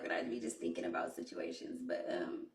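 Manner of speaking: real tears starting, words almost dropping out; fighting back real tears; choking up. Real, not acted. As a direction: voice catching in the throat, nearly breathless. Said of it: "y'all know I'm a crybaby".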